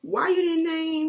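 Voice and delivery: high, loud exasperated mom; exasperated scolding. Real, not acted. Mom scolding kids who forgot their Target order, loud and exasperated. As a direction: let them have it for not speaking up.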